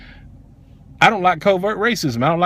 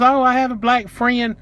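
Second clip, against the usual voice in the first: high-pitched mock excuse; mocking imitation; 8 semitones higher, slower than usual. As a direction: imitate her in a high, drawn-out voice. Mimics the clerk's excuses.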